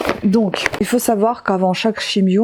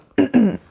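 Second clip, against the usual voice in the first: clearing throat; 5 semitones higher than usual. Real, not acted. Sore throat mid-sentence.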